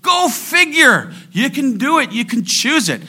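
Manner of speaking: high, loud preacher's exhortation; emphatic preaching. Acted. Preacher urging the congregation to choose joy, high and emphatic. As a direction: drive it home from the pulpit.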